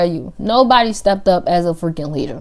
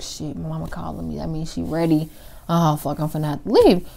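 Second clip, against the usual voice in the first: muttered panic; 2 semitones lower, 6 dB quieter than usual. Retold or imitated. Panicked aside when mom calls.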